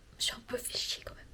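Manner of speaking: a whispered confession to the camera; whispered aside. Real, not acted. Vlogger confides her boredom to viewers, whispering. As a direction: drop to a breathy whisper for the admission.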